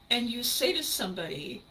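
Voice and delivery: normal talking voice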